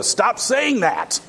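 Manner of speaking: slow, high, exasperated villain line; Count Rugen impression. Count Rugen snapping at Inigo Montoya, slow and high. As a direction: drag it out like a fed-up villain. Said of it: "Maybe like Count Rugen in the, in The Princess Bride as he's replying to Inigo Montoya".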